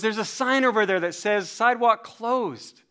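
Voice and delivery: high, slow, insistent scolding; exasperated construction worker. Retold or imitated. Construction man pointing out the closed sidewalk, exasperated. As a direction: spell it out slowly, acted annoyance.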